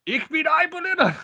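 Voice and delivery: high, far-off put-on voice saying Ich bin ein Berliner; high mock German proclamation; German accent. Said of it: "German jokes".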